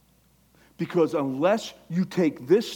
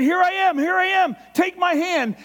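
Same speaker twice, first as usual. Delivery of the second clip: high, loud, urgent call of God; loud outstretched call; calling out loudly; 8 dB louder than usual. Acted. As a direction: shout it out to someone being swept away.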